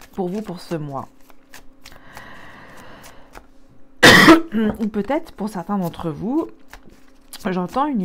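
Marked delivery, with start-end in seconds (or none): 2.7-4.8 loud choking cough; choking on a drink. Real, not acted. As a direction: cough out a drink gone down wrong. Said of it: "j'ai bu de travers".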